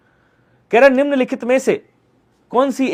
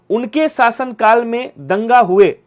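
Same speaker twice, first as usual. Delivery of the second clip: slow reading of the wrong sentence; 3 dB louder, slower than usual. Shown as a demonstration. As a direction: read "उनके शासनकाल में दंगा हुए" slowly, word by word, as the faulty version. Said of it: "नहीं, उनके शासनकाल में दंगा नहीं हुए". (गलत वाक्य को धीरे-धीरे पढ़ना)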